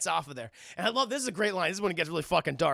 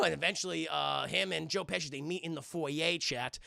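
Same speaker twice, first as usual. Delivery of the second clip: normal talking voice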